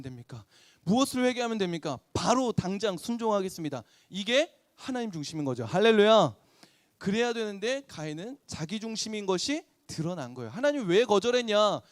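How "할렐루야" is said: loud hallelujah. Real, not acted. Preacher's acclamation. (크게 외치는 할렐루야)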